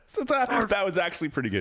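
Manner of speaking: laughing. Real, not acted. Laughing reply.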